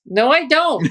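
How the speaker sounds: indignant retort. Real, not acted. Retorting to the article, loud.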